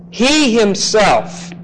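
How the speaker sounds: He himself slow with He stressed; emphatic. Shown as a demonstration. Teacher showing the Greek emphasis, slow stressed He. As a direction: press on He, very slowly. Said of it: "Very, very emphatic in the Greek text".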